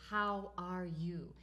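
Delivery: stretched out slowly, each word weighed; quoting the phrase slowly for the viewers; slow, deliberate quote. Shown as a demonstration. Talking about the words.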